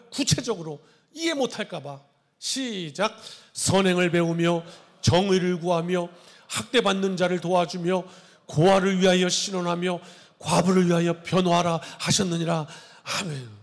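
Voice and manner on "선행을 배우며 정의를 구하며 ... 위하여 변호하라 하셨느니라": formal, measured Bible reading voice; solemn scripture reading. Acted. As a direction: read it out like holy writ from the pulpit. Reads scripture aloud.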